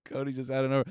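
ordinary talk

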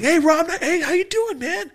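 high, loud fake-friendly hello; outward cheery greeting. To the bailiff, faking a cheerful hello. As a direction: greet him brightly while panicking inside. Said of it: "where I'm like".

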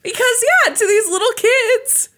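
laugh-crying sad. Real, not acted. Laughing-crying over sad story.

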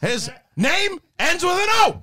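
high, punched movie-star punchline; Al Pacino. Acted. Actor clinches his case against the co-star, high Pacino impression. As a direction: land it as mock-exasperated proof. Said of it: "Pacino just went from being like that really high voice".

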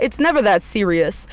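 normal talking voice

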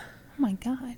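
hushed whispered reaction; whispering. Real, not acted. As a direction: whisper it in disbelief. Reacting to teen tooth extraction.

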